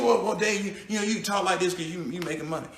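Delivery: soft, low mock doubter; muttered mock objection. Doubters needle Dave about his money, muttered low.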